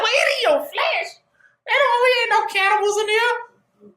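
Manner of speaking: high, laughing mock disbelief; the crowd laughing off eating flesh, high pitched. High laughing crowd voice.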